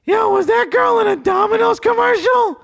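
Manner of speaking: high, loud mock yell; imitating Justin. Friend texting about the date, high excited yell. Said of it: "Justin texted me and he's like".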